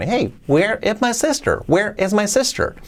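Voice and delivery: "where is my sister" in a high, swooping question tune; with intonation. Shown as a demonstration. Teacher adds intonation for learners, high swooping "where is my sister". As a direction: lift "where is my sister" high, with lively melody. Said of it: "luego le des entonación".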